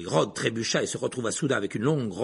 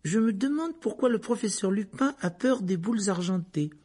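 higher-pitched musing; songeur; 6 semitones higher than usual. A narrator's reading. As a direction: wonder aloud, unforced. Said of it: "d'un air songeur". (ton songeur, voix haute)

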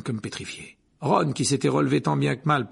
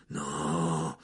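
a low hissed no; low, soft hiss; 8 semitones lower, 4 dB quieter than usual. Acted. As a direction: hiss it through the teeth. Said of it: "dit-il dans un sifflement".

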